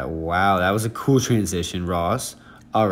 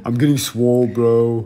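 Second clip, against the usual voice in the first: louder gym bro voice; mock gym bro; 3 semitones higher, 5 dB louder than usual. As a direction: play a pumped-up gym bro. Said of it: "You were like".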